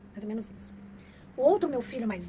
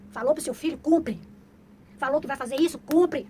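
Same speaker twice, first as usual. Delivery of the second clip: louder, hammering each cumpre; loud emphatic lesson; 2 semitones higher, 8 dB louder than usual. Real, not acted. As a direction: drive home the rule. Hammering rule with loud repeated cumpre.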